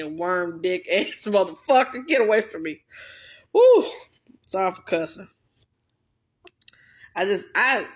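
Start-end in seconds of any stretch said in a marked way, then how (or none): 3.5-3.8 high, loud release cry; loud whoop. Real, not acted. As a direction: blow off steam with a yell. Loud exclamation after venting.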